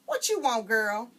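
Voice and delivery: a hint of a busy mother; gruff-ish mother at the sink; Mama's voice. Mama asks her daughter, loud and short.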